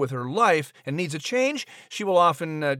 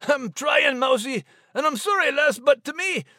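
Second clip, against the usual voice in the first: much higher Scots apology; Scottish Max; 12 semitones higher than usual. Acted. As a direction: shrug it off in a Scottish brogue. Max teases Liz.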